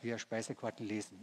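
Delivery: ordinary delivery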